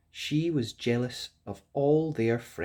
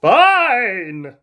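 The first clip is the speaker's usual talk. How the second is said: high, loud huffy prince; sulky prince; 10 semitones higher, 13 dB louder than usual. A narrator's reading. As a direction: give in with a huff. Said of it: "said the prince".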